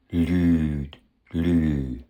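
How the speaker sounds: Lüd and Lü with the ü held very long; extremely long vowel. Shown as a demonstration. Teacher shows syncope's result, overlong vowel demo. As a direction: stretch the ü in Lüd, then Lü without the D. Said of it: "Die Vokale werden extrem lang".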